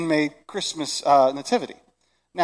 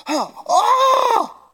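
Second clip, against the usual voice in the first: ecstatic cry; 7 dB louder than usual. Acted. Overwhelmed shepherd.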